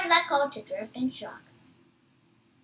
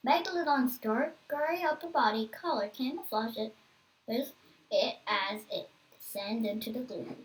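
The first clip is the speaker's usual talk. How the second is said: ordinary delivery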